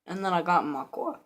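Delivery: ordinary delivery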